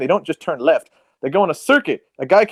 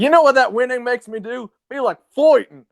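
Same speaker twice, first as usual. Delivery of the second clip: mock Russell Crowe; 7 semitones higher than usual. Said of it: "It's like".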